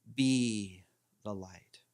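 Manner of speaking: slow, drawn-out "Be", then "the light"; stress on BE. Shown as a demonstration. Teacher shows flowing mantra emphasis, stress on "Be". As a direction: chant it slowly, leaning on "Be". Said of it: "first put an emphasis on the be".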